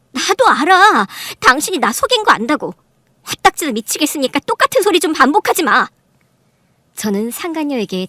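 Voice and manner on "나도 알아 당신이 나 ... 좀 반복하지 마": loud, high, fed-up retort; exasperated anger. Acted. Wife fed up with her husband's repeats, exasperated. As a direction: cut off the repeated confession at full voice.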